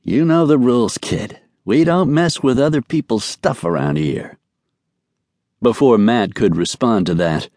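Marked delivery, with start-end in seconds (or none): 0.0-4.4 higher-pitched mock old-time gangster; badly faked gangster voice; bad gangster imitation. A narrator's reading. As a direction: do a hammy old-time gangster, talking out of one side of the mouth. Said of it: "admittedly bad imitation of an old-time gangster".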